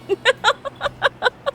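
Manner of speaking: squealing laugh; high laugh. Real, not acted. Vlogger laughing at her own Jeremiah joke, high squeal. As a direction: let out a high giggle.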